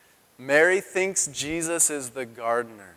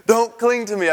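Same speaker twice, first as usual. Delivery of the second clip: higher, louder, with a laugh; playfully shaking off; 8 semitones higher, 6 dB louder than usual. Retold or imitated. To boys hanging on the ankles, playful protest. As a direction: laughingly protest as if someone hangs on you. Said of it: "I picture my boys, like, when they want to hang on".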